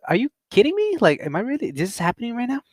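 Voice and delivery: very high-pitched disbelief; high, softening "is this real" disbelief; incredulous. Retold or imitated. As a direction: voice leaps up in retold incredulity. Incredulous at being stopped by officer.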